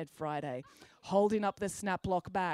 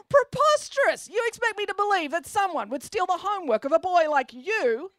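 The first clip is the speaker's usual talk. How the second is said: high, loud scoffing teacher voice; scornful outrage; 10 semitones higher, 10 dB louder than usual. A narrator's reading. Teacher scoffs at the pupil's excuse, high and loud. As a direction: blast his excuse away.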